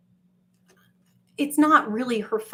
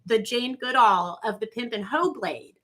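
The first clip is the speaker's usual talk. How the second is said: ordinary talk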